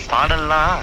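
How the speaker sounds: ordinary delivery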